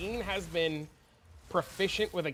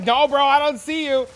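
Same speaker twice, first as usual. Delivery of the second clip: playing dumb; 9 semitones higher, 11 dB louder than usual. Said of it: "I'm like".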